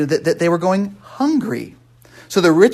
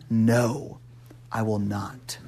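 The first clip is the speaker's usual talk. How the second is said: whispered refusal; 8 semitones lower, 10 dB quieter, much slower than usual. Acted. Reading Paul's refusal.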